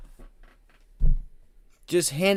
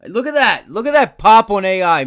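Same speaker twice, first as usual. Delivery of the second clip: loud, high burst of excitement; excited shout; 7 semitones higher, 12 dB louder than usual. Real, not acted. Trader shouting at his screen as the stock spikes, excited. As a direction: yell it as the stock pops.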